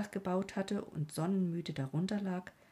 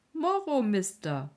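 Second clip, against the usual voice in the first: bright, high call of welcome; cheerful servant greeting; 4 semitones higher, 6 dB louder than usual. Acted. Servant greets his young master, calling out high and cheerful. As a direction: sing out the greeting to the returning rider.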